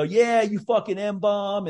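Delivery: slow mock yell from a truck; mild mock yell of a heckler from a pickup truck; retold yell. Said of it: "one guy yelled out the window".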